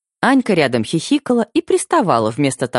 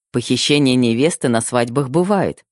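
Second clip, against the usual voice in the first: ordinary delivery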